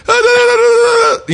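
high, loud mock babble; imitated president stammering. Cohost mimicking the president's stumbling talk, high mock babble. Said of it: "He's like".

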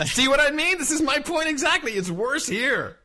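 high and loud, still laughing; triumphant I-told-you-so; excited. Real, not acted. Vindicated, laughing at the news.

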